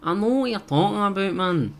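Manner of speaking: lightly voicing a Glasgow man; Glasgow accent. Imitating how Glasgow folk answer, light Glasgow accent. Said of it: "Glasgow's like".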